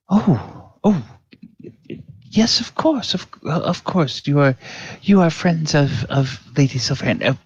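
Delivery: high, stammering, mortified clerk; a flustered clerk backpedalling in a high, panicked voice. Acted. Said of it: "he says".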